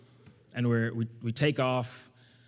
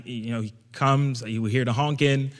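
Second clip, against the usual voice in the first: ordinary talk, nothing marked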